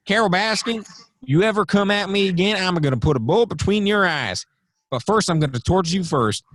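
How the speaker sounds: high-pitched hammed-up threat; Joe Exotic. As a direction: imitate a showman threatening a rival, pitched high. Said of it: "dude, he's like".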